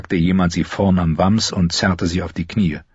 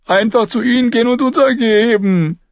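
very high, whiny character voice; thin high voice of a scared companion; Lommi; 4 dB louder than usual. A narrator's reading. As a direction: propose giving up in a high-pitched whine. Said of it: "meinte Lommi".

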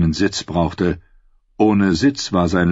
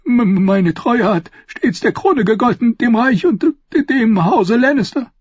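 put-on stammering old man, pitched up; faltering, higher, frightened old voice; trembling; 3 dB louder than usual. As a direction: get the words out through fear. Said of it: "Sein Mund zitterte".

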